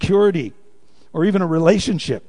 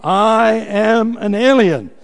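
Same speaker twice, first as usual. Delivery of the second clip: slow, louder and higher, drawn out; firm declaration; 5 semitones higher, 6 dB louder, much slower than usual. Pretend. Refusing a TV ad: slow, firm proclamation. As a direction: plant your feet and proclaim it. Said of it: "what are you gonna say".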